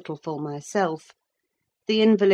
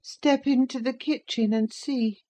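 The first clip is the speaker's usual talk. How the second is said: put-on peevish sickly boy; 9 semitones higher than usual. A narrator's reading. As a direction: whine at the servant who ignores you. Linton orders the narrator.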